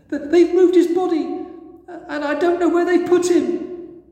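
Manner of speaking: high and loud, breaking with tears; distraught crying. A narrator's reading. Mary to the angels, crying about the missing body. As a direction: plead through sobs, voice up high. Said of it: "She was crying her eyes out".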